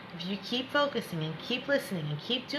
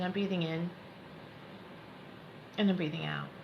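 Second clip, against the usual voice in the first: soft, lowered breathing-exercise voice; guided meditation; 3 semitones lower, 6 dB quieter than usual. Acted. Coach guiding students to breathe in and out, soothing meditation voice. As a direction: ease them gently into the breath, leaving space.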